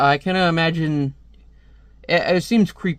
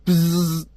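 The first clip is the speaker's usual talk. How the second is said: buzzing noise made by mouth; imitated buzz. Reader voicing a comic's sound effect, mouth-made buzz. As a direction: spell out a zap with the lips.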